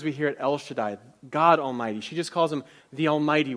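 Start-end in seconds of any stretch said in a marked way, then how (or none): none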